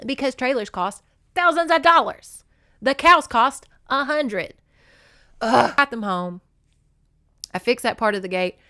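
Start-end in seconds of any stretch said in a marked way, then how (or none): 0.0-4.5 higher and louder, the last line slowed; exasperated, raised voice; exasperated rant. Real, not acted. Exasperated explaining cost. 5.4-5.7 frustrated groan. Real, not acted.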